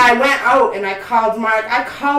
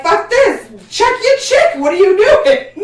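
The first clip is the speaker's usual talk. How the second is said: much higher, loud, mocking yell; shouting at the boyfriend to check his girlfriend; angry dudes yelling; 9 semitones higher, 6 dB louder, slower than usual. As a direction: bark it at him like a fed-up stranger.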